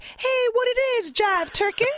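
mock groovy seventies hipster greeting; seventies jive talk. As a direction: greet someone like a time traveler fresh from the disco era. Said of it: "I sound like the equivalent of someone who, like, time traveled".

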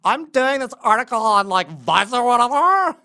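put-on high, loud ditzy valley girl; chirpy airhead valley girl. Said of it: "she talks like a valley girl".

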